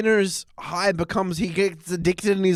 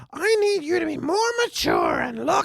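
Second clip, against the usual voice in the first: shrill Marge impression; 10 semitones higher than usual. Mocking Marge scolding Bart about his father, high shouted. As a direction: squeal it like a nagging mum. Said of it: "Marge was all like".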